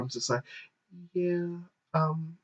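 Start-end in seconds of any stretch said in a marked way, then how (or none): 1.1-2.3 high, very soft, halting murmur; embarrassed whisper. Retold or imitated. Shy shopper asks a clerk for pads, whispering. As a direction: shrink it to a hesitant, high near-whisper. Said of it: "And I'm just whispering".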